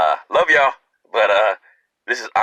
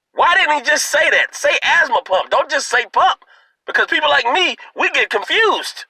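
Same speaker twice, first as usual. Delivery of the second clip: exasperated. Retold or imitated. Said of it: "I'm like".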